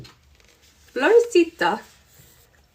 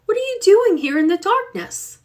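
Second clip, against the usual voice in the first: high, gentle call of a kind aunt; 10 semitones higher than usual. Acted. Aunt finds the girl alone in the dark, gentle call.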